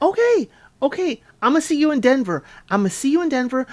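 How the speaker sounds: high, hurried mock reassurance; placating boyfriend. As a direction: imitate him agreeing eagerly, pitched right up. Acting out his accommodating reply.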